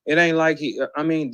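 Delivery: ordinary talk, nothing marked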